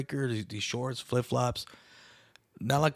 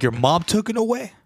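much higher, louder mock disbelief; incredulous; 10 semitones higher, 6 dB louder than usual. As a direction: echo it back in shock. Disbelief at homeless guy's excuse.

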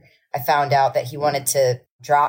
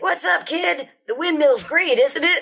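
much higher put-on voice, easygoing rock star; 12 semitones higher than usual. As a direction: play a cool rock legend greeting a kid, pitched way up. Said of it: "And he was like".

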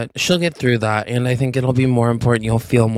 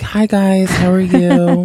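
high, loud customer-greeting voice; server voice; 6 semitones higher, 4 dB louder, slower than usual. Shown as a demonstration. Former server greeting a table of customers, high soothing server voice. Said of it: "this was my server voice".

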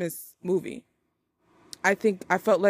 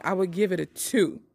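ordinary talk

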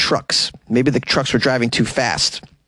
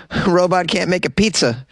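normal talking voice

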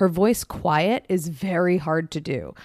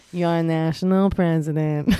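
slow, grand sign-off in her voice; Fran Drescher impression; 3 semitones lower, slower than usual. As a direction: announce yourself like a proud union leader. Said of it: "And then she goes".

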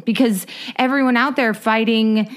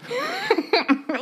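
high, soft burst of laughter; real laugh; 4 dB quieter than usual. Real, not acted. Co-host reacts to the Chili's joke, laughing. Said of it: "I'm laughing because I joke".

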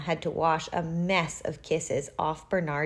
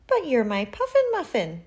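lightly voiced cooing aunt, higher; doting aunt; 6 semitones higher than usual. A narrator's reading. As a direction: gush over a little nephew.

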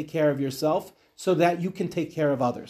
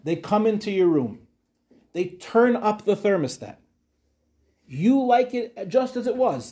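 ordinary delivery

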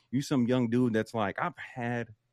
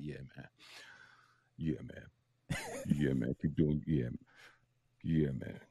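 deep man voice; 9 semitones lower, 4 dB quieter, slower than usual. Acted. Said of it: "Sorry for the performance".

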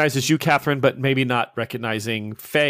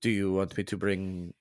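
soft, lightly voiced Italian priest, gentle and hopeful; a hint of a kindly father wishing John well; 6 semitones lower, 10 dB quieter than usual. The priest hearing of John's retreat, softly Italian.

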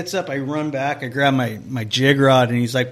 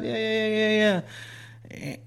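lightly voiced anglers, higher, agreeing fast; a quick mock reply from the other boat, pitched up; imitating the other anglers; 9 semitones higher than usual. Other anglers answering, voiced high.

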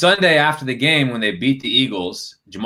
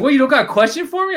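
much higher, quick imitation of a needling question; high-pitched mock taunt; mock challenge; 4 dB louder, faster than usual. Player needling the reporter on a call, mock challenge.

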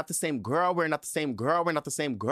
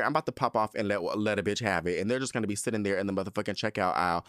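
ordinary delivery

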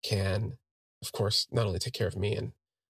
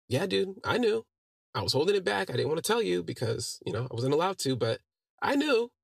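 excited gloating; 8 semitones higher than usual. Retold or imitated. James gloating he knew.

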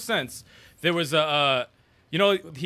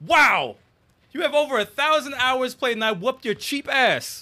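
mocking gloat; 7 semitones higher, 4 dB louder than usual. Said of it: "I'm like".

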